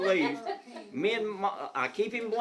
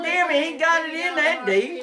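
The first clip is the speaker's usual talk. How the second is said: scolding laugh; 11 semitones higher, 6 dB louder than usual. Retold or imitated. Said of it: "I said".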